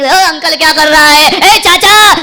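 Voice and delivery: loud slow disrespectful shout; rude yell at uncle. Retold or imitated. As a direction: holler rudely at your own uncle. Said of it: "कभी अपने चाचा को uncle जी कह करके नहीं".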